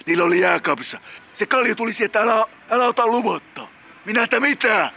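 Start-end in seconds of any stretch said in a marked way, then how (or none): 4.1-4.9 high, indignant challenge, sharper on the repeat; too-loud retort. Acted. Caller retelling his challenge to the bald man, too-loud retort. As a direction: throw the question out at a raised pitch. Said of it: "Jatka pienemmällä volyymilla".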